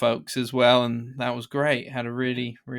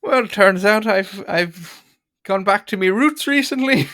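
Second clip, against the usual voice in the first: higher, slow hammed-up Irish accent; bad Irish accent; 6 semitones higher, 5 dB louder than usual. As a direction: lay on a cheesy Irish lilt. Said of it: "the worst Irish accent I've ever heard".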